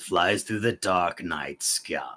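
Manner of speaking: slow, low villain voice; Palpatine. Acted. Villain musing on freedom, slow and sinister. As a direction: draw it out, sinking low at the end. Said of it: "Now I'm turning into Palpatine".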